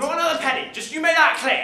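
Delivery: loud, high shout from across the room; angry shout. Acted. Dad yelling at mum about money for Anne, angry shout. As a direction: bark it at the phone from afar.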